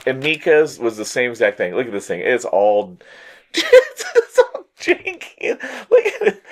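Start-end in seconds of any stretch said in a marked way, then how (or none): 3.3-6.4 very high, slow, laugh-cracked wail; half-laughing despair over a bad gift; laughing whine. Real, not acted. Laughing despair over fake toy.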